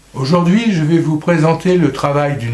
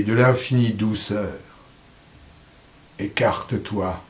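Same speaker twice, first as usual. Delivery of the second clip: poem recitation; 4 semitones lower than usual. Acted. A poet's reader telling death to step aside, solemn recitation. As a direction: recite solemnly, pausing after each line.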